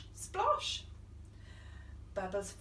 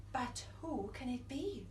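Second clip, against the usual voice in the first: usual speaking voice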